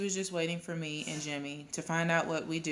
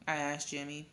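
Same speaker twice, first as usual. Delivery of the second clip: usual speaking voice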